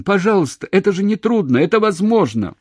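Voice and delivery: high, loud desperate begging; shouted plea. A narrator's reading. Man begging her to come, shouting. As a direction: plead at the top of your voice. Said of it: "закричал он".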